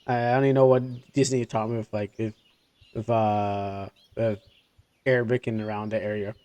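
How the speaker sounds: usual speaking voice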